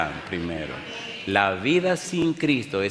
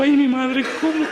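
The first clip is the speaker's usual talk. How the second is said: very high, loud squeal of fright; hammed-up yelp of dismay; mock panic; 6 dB louder than usual. Preacher recalls facing the outstretched hand, comically terrified. Acts out his panicked reaction.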